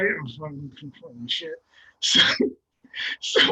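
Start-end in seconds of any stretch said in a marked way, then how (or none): 0.0-1.5 muttered dismissal. Retold or imitated. Teammate mumbling dismissal.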